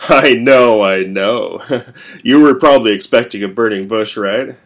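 loud, amused teasing; mocking stranger. A narrator's reading. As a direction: tease him knowingly, chuckling. Stranger mocks Falkir.

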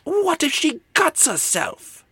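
very high, slow mock shriek; screamed. A narrator's reading. Mother fretting over the sword, high acted shriek. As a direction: wail it in exaggerated alarm. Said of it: "she screamed".

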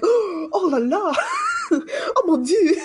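high, loud, hammed-up French exclaiming; french chef. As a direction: squeal it like an overdramatic Frenchman. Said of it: "If he went to Beauxbatons".

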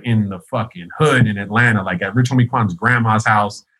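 normal talking voice

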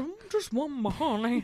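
lightly voiced Pooh Bear, a slightly different timbre; a hint of a dozy bear who only wants honey; playful, understated Pooh impression. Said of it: "I would be Pooh".

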